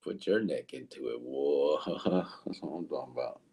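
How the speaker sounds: low dopey imitation; mock deep voice. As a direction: boom it out like a goof. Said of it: "Javier said".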